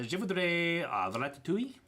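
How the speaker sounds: clumsy French. Retold or imitated. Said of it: "I kept trying to order ratatouille".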